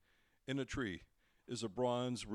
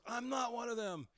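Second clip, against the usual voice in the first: indignant denial; 11 semitones higher than usual. Retold or imitated. Peter denying Jesus to a servant girl, indignant denial. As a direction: deny it with pitch jumping up.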